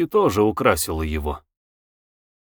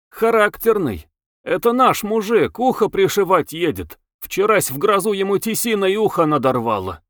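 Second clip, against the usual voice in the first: higher-pitched lad's voice, gossiping; chatty village lad; 7 semitones higher than usual. A narrator's reading. Young coachman to his passenger about the peasant, chatty.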